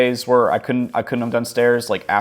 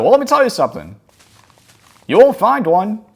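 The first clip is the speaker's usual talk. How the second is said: put-on higher, louder snippy old geezer; cranky old man; 12 semitones higher, 8 dB louder than usual. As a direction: lecture the young guy like a smug old man. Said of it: "He had a real bitch attitude too".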